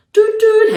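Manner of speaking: high loud doot doot like a toy train; train horn. Acted. The train greeting the controller with a horn. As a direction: toot it like a cheery engine.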